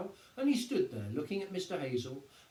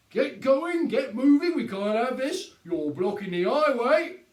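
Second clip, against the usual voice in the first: higher, louder, slow brisk order; policeman ordering crowd; 9 semitones higher, 11 dB louder than usual. A narrator's reading. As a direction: move the gawkers along. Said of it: "Sergeant Samways stalled".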